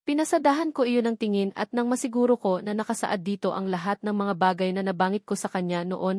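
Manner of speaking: plain reading voice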